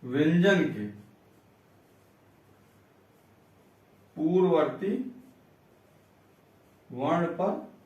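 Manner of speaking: slow teacher giving out a rule piece by piece; dictation. Shown as a demonstration. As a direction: dictate with long pauses between words.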